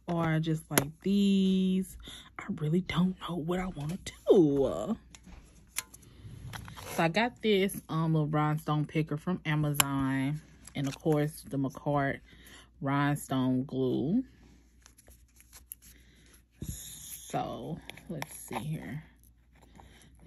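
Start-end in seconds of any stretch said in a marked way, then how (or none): 0.7-5.0 whispered indecision. Real, not acted. Torn between rhinestones, whispering to herself. 16.6-20.2 slow soft whisper. Real, not acted. Placing rhinestone glue carefully, slow whisper.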